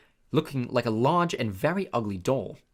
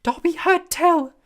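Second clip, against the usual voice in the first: high, loud squeaky elf voice; a small eager servant creature, awed; squeaky house elf; 9 dB louder, slower than usual. A narrator's reading. House elf greeting Harry, high squeaky and awed. Dobby voice.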